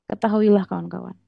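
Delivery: normal talking voice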